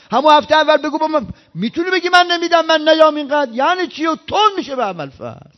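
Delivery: loud, high, indignant complaining; angry rant. Retold or imitated. Pilgrim venting at the saint, loud and indignant. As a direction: rail at him, aggrieved and loud.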